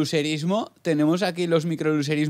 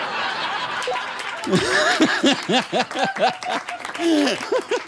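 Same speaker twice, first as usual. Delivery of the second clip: high rising burst of laughter; big laugh; 13 semitones higher than usual. Real, not acted. (carcajada aguda)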